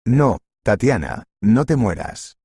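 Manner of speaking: ordinary delivery, nothing marked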